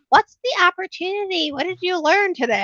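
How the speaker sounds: high, bright-eyed coach questions; chirpy coach voice. Retold or imitated. Remembering her coach's voice after hospice nights, a chirpy mock coach. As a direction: mimic the upbeat coach asking. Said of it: "my other coach's voice in my head going".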